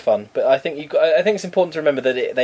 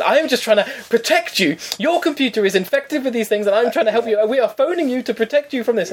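high-pitched, insistent imitation; protesting scammer; 10 semitones higher than usual. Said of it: "And he said".

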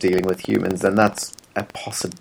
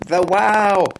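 imitating a toddler's wow; 8 semitones higher than usual. Uncle mimics his niece marvelling at lights. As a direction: squeal "The wow!" like a thrilled baby.

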